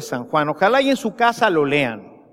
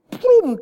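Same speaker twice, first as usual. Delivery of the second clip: thunder boom; 4 dB louder than usual. Retold or imitated. Thunderclap retold.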